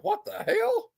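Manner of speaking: very high, squeaky mock disbelief; retold bafflement. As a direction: replay the shock in a falsetto squeak. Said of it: "I saw, I was like".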